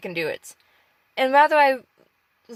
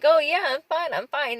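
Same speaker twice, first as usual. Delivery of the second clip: higher-pitched, put-on "I'm fine"; fake cheerfulness; 5 semitones higher than usual. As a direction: cover the struggle with a big smile. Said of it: "give this big smile".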